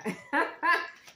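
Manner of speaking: loud burst of joyful laughter. Real, not acted. Reader overjoyed by her reading, laughing loudly.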